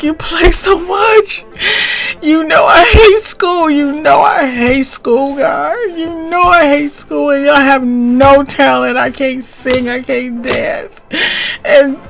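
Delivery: high, loud crying plea to God; tearful pleading. Retold or imitated. Teenager sobbing at God over his future. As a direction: wail it out through tears like a desperate teenager. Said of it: "I'm bawling my heart out".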